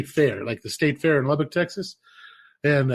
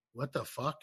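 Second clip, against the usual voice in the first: hushed; 12 dB quieter than usual. Retold or imitated. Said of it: "everybody's like".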